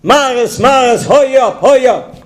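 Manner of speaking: high, urgent call to her husband; hammed-up panicked yell. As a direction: call frantically up the stairs. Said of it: "She yells out".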